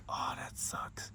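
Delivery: breathy disappointed whisper. Real, not acted. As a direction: mutter it under your breath. Disappointed aside under breath.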